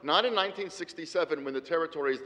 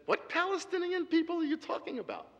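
much higher, mock incredulous voice; imitating Golda Meir; 13 semitones higher, 4 dB quieter than usual. Golda Meir derisively dismissing the Palestinians, much higher mock scoff. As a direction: scoff at the very idea in a high voice. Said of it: "Golda Meir ... said".

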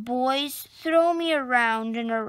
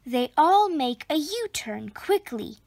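usual speaking voice

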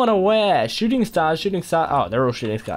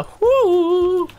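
long high "hoo"; high playful hoot; 12 semitones higher, 4 dB louder than usual. Pretend. Gamer showing off practised dance moves, high hoot. Sound effect for dance move.

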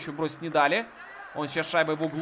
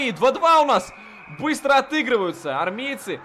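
excited goal call; 6 semitones higher, 3 dB louder than usual. Real, not acted.

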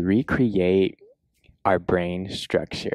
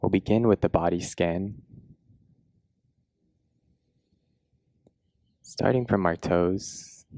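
guided meditation; 4 dB quieter than usual. Acted. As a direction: guide them gently into a body scan, unhurried.

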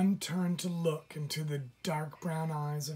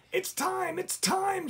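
very high, loud bird shriek; 4 dB louder than usual. Acted. Blackbird shrieks the news to the whole wood, very high and loud. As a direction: shriek it like a blackbird sounding the alarm. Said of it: "shrieked the red-winged blackbird".

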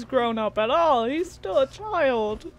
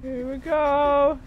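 normal talking voice

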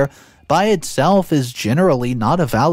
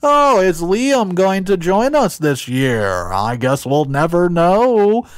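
mocking family joke; 5 semitones higher than usual. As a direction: tease like a family joke at dinner. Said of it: "It's become a running joke at the table".

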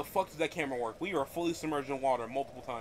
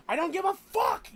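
shouting in frustration; 14 semitones higher, 7 dB louder than usual. Real, not acted. Ranting about royal family.

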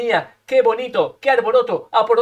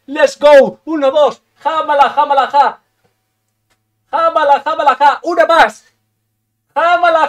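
rallying shout; 3 semitones higher, 6 dB louder than usual. Real, not acted. Gamer asking chat to lend their power, building shouted chant.

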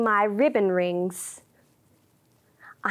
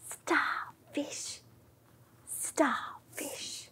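faint unvoiced whisper; 2 semitones higher, 13 dB quieter, much slower than usual. Shown as a demonstration. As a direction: barely breathe the words. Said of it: "Super quiet little starfish".

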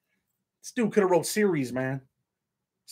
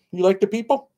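high, louder cooing question; 6 semitones higher, 5 dB louder than usual. Real, not acted. Dad asking baby.

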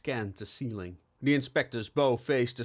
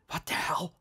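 quivering voice; 5 semitones higher than usual. A narrator's reading. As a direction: voice trembling in fright. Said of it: "Bixby's voice quivered".

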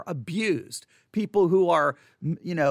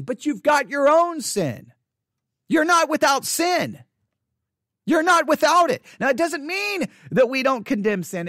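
fiery preaching; 6 semitones higher than usual. Acted. Preacher warning his congregation, at full force. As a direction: hammer the point home like a preacher at full force.

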